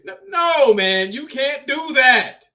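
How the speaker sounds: loud, high-pitched objection, drawn out; emphatic protest. Real, not acted. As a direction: object loudly, stretching the words. Protesting the casting of Marley.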